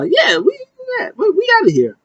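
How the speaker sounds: very high, squealing mock wrestler voice; mock Sasha exit. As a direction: squeal it out like a diva storming off. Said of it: "And then Sasha goes".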